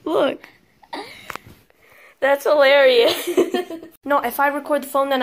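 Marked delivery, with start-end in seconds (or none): none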